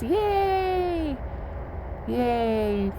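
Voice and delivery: high squeal of joy; excited cheer. Real, not acted. Cheering at full eclipse.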